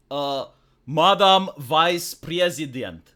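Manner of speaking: put-on slow, thick Eastern European accent; mock Ukrainian accent. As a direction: hail her formally in a fake Ukrainian accent. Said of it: "is it a Ukrainian?".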